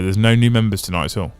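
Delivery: usual speaking voice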